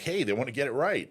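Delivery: eager retold excitement, pitched high; excited. Speaker recalls hoping reporters would get it right, excited. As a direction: brightly act out being all excited. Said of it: "I was all excited".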